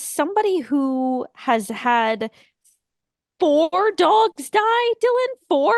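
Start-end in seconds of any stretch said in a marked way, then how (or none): 3.4-5.7 slow, high-pitched incredulous stress; indignant disbelief. Real, not acted. Friend who lost dogs confronts Dylan, indignant disbelief. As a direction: hammer each word in disbelief. Scolding friend for film choice.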